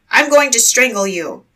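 put-on the dead man cries a threat at the boy; cried out. A narrator's reading. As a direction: growl the threat at him. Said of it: "the dead man cried out".

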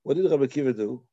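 normal talking voice

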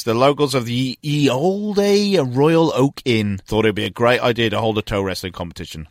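normal talking voice

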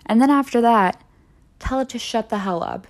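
ordinary talk, nothing marked

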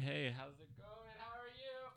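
greeting, fading and rising as if off-mic; far from the mic. Shown as a demonstration. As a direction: call it from across the room. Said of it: "we could be like".

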